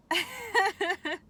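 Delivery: bright burst of laughter; loud high laugh. Real, not acted. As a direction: laugh it off at yourself. Laughing after asking viewers.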